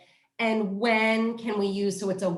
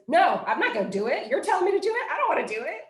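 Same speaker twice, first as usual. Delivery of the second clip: high, whiny refusing child, lightly voiced; defiant child; 7 semitones higher, much faster than usual. Parent mimicking Kim refusing to do it, mock whiny child. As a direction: pout it out like a kid who won't. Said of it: "what Kim said she wanted to do was".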